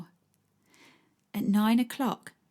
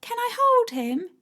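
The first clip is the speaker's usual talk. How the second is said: put-on much higher eager child voice; a boy begging to hold the dog; child's request; small boy asking. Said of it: "Freddie asks".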